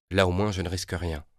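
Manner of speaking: usual speaking voice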